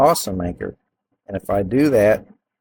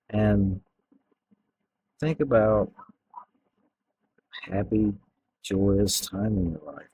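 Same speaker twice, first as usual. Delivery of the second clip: guided meditation. Acted. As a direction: ease them into relaxing, unhurried. Deepening hypnosis with eye cues.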